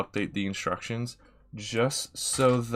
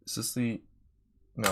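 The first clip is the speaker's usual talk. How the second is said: usual speaking voice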